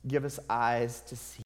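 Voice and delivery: earnest pleading prayer; heartfelt sermon-closing prayer. Real, not acted. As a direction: pray honestly for help, intimate.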